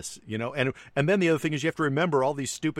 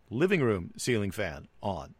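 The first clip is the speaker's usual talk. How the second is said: slow, stiff, spelled-out command; slow robotic command. Shown as a demonstration. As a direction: talk like a voice command. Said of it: "you end up talking like this".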